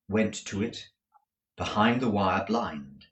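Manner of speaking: ordinary delivery, nothing marked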